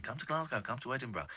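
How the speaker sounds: lightly imitated Cockney plea; Cockney accent. Imitating listeners asking the show to visit, light Cockney accent. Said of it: "in a Cockney accent".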